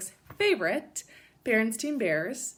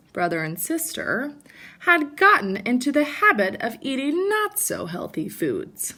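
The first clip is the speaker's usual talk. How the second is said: lively storybook read-aloud; animated picture-book narration; reading to children; 3 semitones higher, 8 dB louder than usual. Acted. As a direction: read it like story time for kids.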